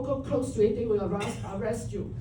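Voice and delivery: usual speaking voice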